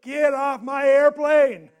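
slow, high, loud yell; screaming. Retold or imitated. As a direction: scream it at the top of your lungs. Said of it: "screaming at the top of his lungs".

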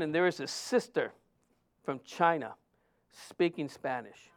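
ordinary talk, nothing marked

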